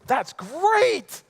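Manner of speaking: high, loud delighted exclamation; delighted Eli. Acted. Eli reacts to the news, high and delighted. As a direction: gush with delight.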